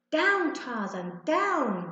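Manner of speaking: slow, loud fierce command. A narrator's reading. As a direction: hold the dog back with a fierce order. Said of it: "Ben said fiercely".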